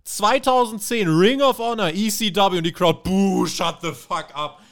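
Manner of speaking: high, loud hostile booing chant; hammed-up jeering fans; mock crowd. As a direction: boo like an angry old crowd.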